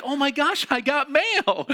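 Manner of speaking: high, loud squeal of joy over mail; mock excitement. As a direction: gush like a lonely student finding mail. Mock excited student at mailbox.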